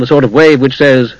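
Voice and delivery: plain reading voice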